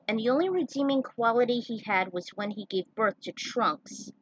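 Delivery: ordinary delivery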